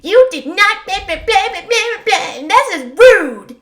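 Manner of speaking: loud, high mocking rant; shrill-pitched sneering imitation, stretched out on the last word; mock someone scolding, way up high and loud; Amber impression. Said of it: "that's how Amber talks".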